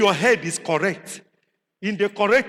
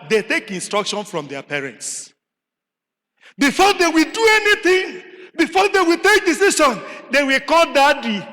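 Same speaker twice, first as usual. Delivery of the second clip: ordinary talk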